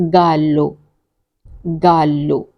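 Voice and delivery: ordinary talk, nothing marked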